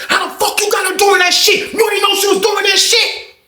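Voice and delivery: high, screaming rage into the receiver; screaming at the phone. Retold or imitated. Banks screaming at the baby mama over the phone. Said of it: "He started screaming at the phone".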